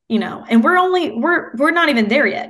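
bright and animated; lively, rising with delight; excited. Real, not acted. Excited about Thanksgiving week.

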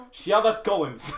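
put-on slow hammy Russian accent, laughing; drawn-out Russian accent through laughter. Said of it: "Do that Russian accent one more time".

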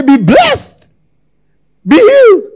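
high, loud preacher's scream; full-throated pastor's shout; screamed blessing. Shown as a demonstration. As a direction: scream the blessing over them. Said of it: "I can scream and say".

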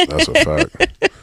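high, slow mock agreement. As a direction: sing it up high to tease.

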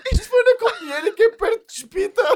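voz de gozo muito aguda; troça aguda a rir. Acted. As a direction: repetir a piada alheia em falsete trocista, a rir. Goza com a piada do amigo. (gozo em voz fininha)

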